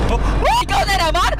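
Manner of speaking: high startled cry; alarmed shout. Real, not acted. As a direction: yell out at a returning threat. Threat appears in game again. (gulat na sigaw)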